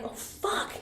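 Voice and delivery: urgent whispered curse; whisper yelling. Retold or imitated. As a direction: hiss it so nobody wakes up. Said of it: "we're whisper yelling at each other".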